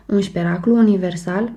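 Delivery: usual speaking voice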